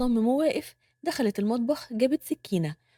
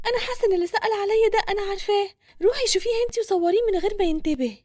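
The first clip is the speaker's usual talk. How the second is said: high, quick anxious voice; worried young woman; 8 semitones higher, faster than usual. A narrator's reading. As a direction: urge a friend on, fast and high. Narrator voices anxious Nour. (صوت بنت قلقة، عالٍ وسريع)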